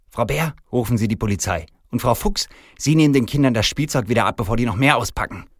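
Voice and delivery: irate orders. Acted. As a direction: order the staff about crossly. Angry manager orders his staff.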